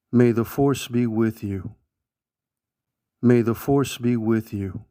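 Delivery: normal talking voice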